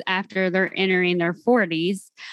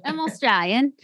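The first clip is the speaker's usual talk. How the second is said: higher-pitched amateur Australian accent; attempted Australian accent; 5 semitones higher than usual. Shown as a demonstration. Kentucky host copying her Australian guest, attempted Australian accent. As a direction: mimic an Australian, with a country tinge. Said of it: "it probably sounds really country".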